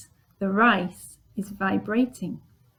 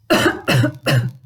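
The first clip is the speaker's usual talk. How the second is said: loud mock cough; 3 semitones higher, 5 dB louder than usual. Shown as a demonstration. Teacher coughs for the pupils, mock cough. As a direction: fake a cough. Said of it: "I can cough".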